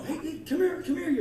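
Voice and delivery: high, loud call down the hall. Acted. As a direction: beckon a kid who has run ahead. Calls kid down the hall.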